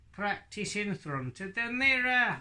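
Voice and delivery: high northern lad puppet voice; Hadley. Acted. Puppet answering how to practise, high northern lad voice. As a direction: pipe up as a northern puppet boy. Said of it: "he speaks with a northern accent".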